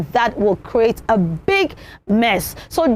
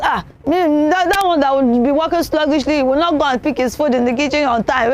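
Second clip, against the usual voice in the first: put-on complaining hungry child; 2 semitones higher than usual. Child back from school refusing to wait for food, impatient complaint. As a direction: whine like a famished schoolchild, rushing at the end.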